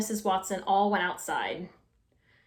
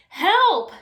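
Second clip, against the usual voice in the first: calling for help; 9 semitones higher, 8 dB louder than usual. A narrator's reading. Trapped couple calling out.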